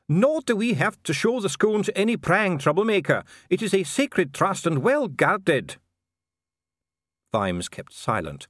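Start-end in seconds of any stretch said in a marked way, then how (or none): 0.1-5.8 high, agitated dwarf dignitary; protesting dwarf official. Acted. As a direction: protest indignantly in a high-pitched voice. Dee keeps protesting.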